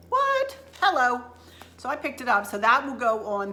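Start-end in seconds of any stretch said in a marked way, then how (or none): 0.1-1.2 very high squeaky "What?"; mock disbelief. Real, not acted. Bragging about a bargain to viewers, mock disbelief. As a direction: squeal in delighted shock. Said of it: "mock disbelief at price".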